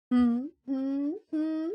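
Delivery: ordinary delivery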